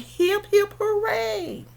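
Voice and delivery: high-pitched hip-hip, drawn-out falling hooray; cheering hippo. Acted. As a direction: cheer as a jolly cartoon animal.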